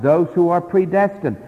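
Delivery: ordinary delivery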